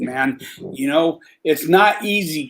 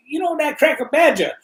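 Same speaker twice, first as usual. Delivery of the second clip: higher put-on voice for the inmate naming the cellmate; mock inmate voice; 10 semitones higher than usual. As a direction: voice the inmate naming Badger, pitched up.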